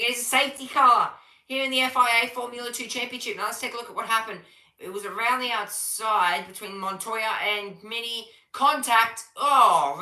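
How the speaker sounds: live call building to an exclamation; excited play-by-play. Real, not acted. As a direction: announce the safety car with urgency. Live call of safety car.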